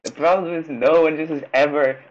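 ordinary delivery

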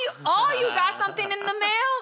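very high, loud feigned amazement; put-on astonishment, rising at the end; mock surprise. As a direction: ask it in an exaggerated squeal. Acts excited reply to mom.

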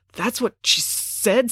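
slow, halting reply in a put-on voice; lightly voiced hesitant character. A narrator's reading. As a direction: say it slowly, stumbling a little. Joe's slow, stuttered reply in a story.

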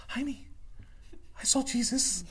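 put-on high little-child voice; childlike angelic voice. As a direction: imitate a small angelic child. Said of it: "in her little childlike angelic voice".

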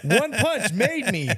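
high, slow, through laughter; laughing boast. Real, not acted. Boasting to his cohost about the knockout, laughing. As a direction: brag while cracking up.

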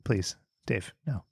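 soft, pleading "Please, Dave, no"; hushed, begging "Please, Dave, no". Shown as a demonstration. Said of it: "hashtag no".

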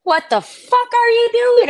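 high, slow disbelieving outburst; exasperated yell. Retold or imitated. Fan yelling at the player's mistake, high and slow. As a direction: stretch it out in disbelief. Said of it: "and I'm like".

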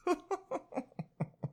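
soft high laugh; breathy laughing. Real, not acted. Laughs at own joke.

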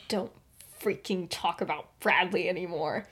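low, soft, restrained anger; quiet seething warning. Retold or imitated. She warns her friend off the subject, quietly seething. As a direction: shut the topic down in a low voice. Angry girl refuses to discuss Bradley.

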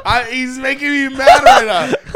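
high, loud, laughing protest; laughing hard. Real, not acted. Podcaster teased by co-host mid-story, laughing helplessly. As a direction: crack up while trying to get the words out.

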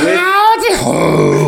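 very high, slow cartoon squeal; high-pitched drawn-out character catchphrase; Mister Hanky. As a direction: stretch the greeting out in falsetto. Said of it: "the high-pitched voice".

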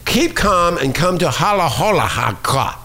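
garbled gag-like mispronunciation; mangled mosque name. Acted. Host mocking a mosque name, garbled retching sound. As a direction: choke out the foreign name as if gagging. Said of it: "You sound like you're throwing up in your face".

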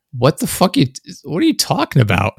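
high, disbelieving what the fuck; incredulous. Real, not acted. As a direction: react like it came out of nowhere. Baffled by the politics jab.

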